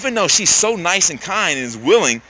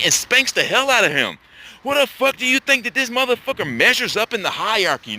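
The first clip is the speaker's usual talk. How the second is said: usual speaking voice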